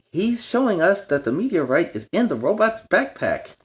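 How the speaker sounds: high, eager pup voice; excited pup. Acted. Chase telling the team, high and eager. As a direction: explain it with a rush of excitement.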